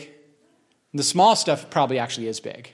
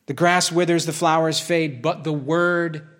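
slow, solemn closing reading; scripture reading; slower than usual. Acted. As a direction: draw out the last line as a promise.

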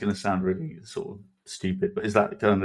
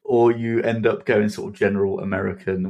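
ordinary delivery

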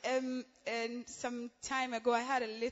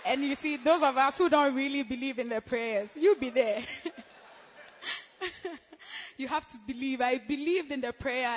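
loud exhortation; 3 semitones higher, 9 dB louder than usual. Real, not acted. Exhorting congregation loudly.

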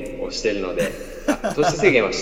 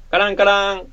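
door bell sound; 10 semitones higher, 7 dB louder than usual. Acted. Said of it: "かっこ。".

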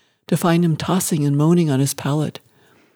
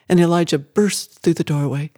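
ordinary delivery

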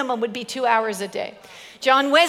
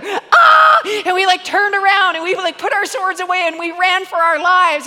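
louder and higher, building to a shout; excited retelling; 4 semitones higher, 4 dB louder than usual. Retold or imitated. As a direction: tell the vision breathlessly, growing frantic. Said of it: "And he said".

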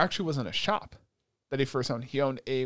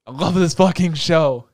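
louder, slower declaration of love; emphatic gush; 3 semitones higher, 9 dB louder than usual. Real, not acted. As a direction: proclaim it with full passion. Gushing about the finale.

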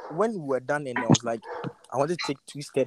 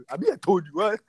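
ordinary delivery

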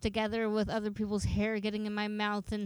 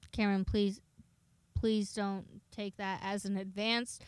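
ordinary talk, nothing marked

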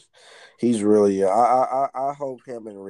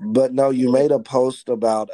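ordinary delivery

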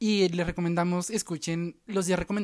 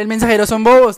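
a loud jokey put-down; loud mock retort; 3 semitones higher, 15 dB louder than usual. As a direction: throw it out loud and teasing. Retold retort, much louder. (réplica burlona y fuerte)

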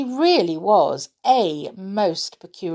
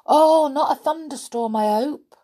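high, louder worried exclamation; anxious; 9 semitones higher, 4 dB louder than usual. A narrator's reading. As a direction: fret about what might be coming. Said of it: "he looked up anxiously".

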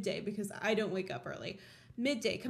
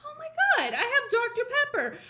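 high, quick excited squeal of words; hammed-up excitement; acted delighted discovery; 11 semitones higher, 4 dB louder, faster than usual. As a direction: gush it fast and high, mock-amazed. Acting out finding the soda.

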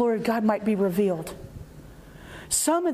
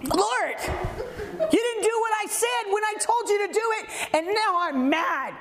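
mock indignant; 7 semitones higher than usual. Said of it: "get mad at me".

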